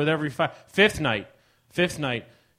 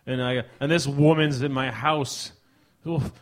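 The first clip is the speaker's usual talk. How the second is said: usual speaking voice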